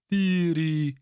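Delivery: high, loud cry of a spell word; sorcerer's spell word. A narrator's reading. Sorcerer tearing out the marble, loud spell cry. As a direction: hurl the word like magic. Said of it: "The first of the sorceress' words twisted sound".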